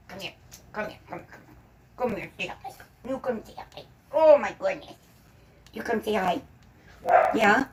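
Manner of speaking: calling her little dog over, hushed and coaxing. Real, not acted. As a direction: gently call the puppy over.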